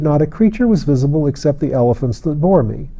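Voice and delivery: plain reading voice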